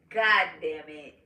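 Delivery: slow, voiced retold curse; drawn-out curse. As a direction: say the curse aloud and stretch it out. Same curse repeated aloud, drawn out.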